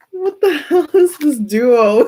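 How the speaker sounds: high, slow, half-crying laugh; words squeezed out between giggles. Real, not acted. Reader losing it over the odd pair, laughing.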